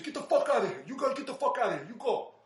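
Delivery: high, loud warning shout, laughing through it; urgent shout. Retold or imitated. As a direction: yell at him to get out fast. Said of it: "other dude said".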